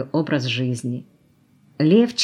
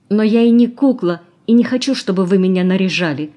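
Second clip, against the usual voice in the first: higher, louder indignant protest; 5 semitones higher, 7 dB louder than usual. A narrator's reading. Valya objects to being dressed up, protesting. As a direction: object firmly, raising pitch and volume. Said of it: "запротестовала Валя".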